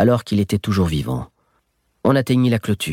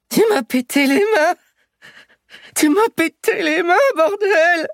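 put-on very high, wailing old man in pain; anguished yell. Old thief with broken hands wailing at the boy, very high. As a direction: howl it over broken hands.

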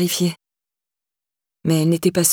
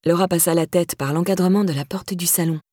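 ordinary delivery

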